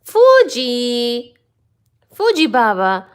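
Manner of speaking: loud call to the cat. Real, not acted. Calling the cat again.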